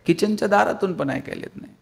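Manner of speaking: ordinary delivery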